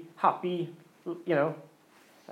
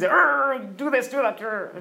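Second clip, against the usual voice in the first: higher, louder little hothead giving orders; bossy Anger; 6 semitones higher, 3 dB louder, slower than usual. Retold or imitated. Preacher voicing Anger to the congregation, higher bossy growl. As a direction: bark the orders like a fiery little character.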